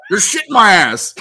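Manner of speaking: loud, high comic shout; mock-outraged guy shout; guys' stall reply. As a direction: yell it out through a laugh.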